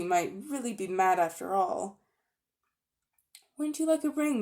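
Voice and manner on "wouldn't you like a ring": coaxing uncle. Acted. Uncle Andrew coaxing Polly.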